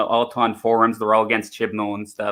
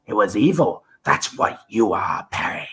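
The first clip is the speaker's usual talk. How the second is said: higher, menacing, sinking to a whisper; accusing Doctor; 7 semitones higher than usual. Acted. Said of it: "let me try the fifth and the sixth".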